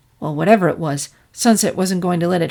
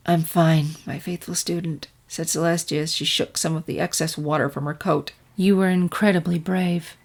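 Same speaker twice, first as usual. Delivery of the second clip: lightly lowered, soft calm mentor; gentle princess voice; 3 semitones lower, 6 dB quieter than usual. Acted. Princess calmly reassures her student, lightly voiced soft mentor.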